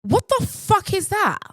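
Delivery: scandalised, high exclamation; incredulous outburst. Real, not acted. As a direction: burst out in high-pitched disbelief. Incredulous outrage at the idea.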